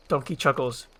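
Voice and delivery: ordinary talk, nothing marked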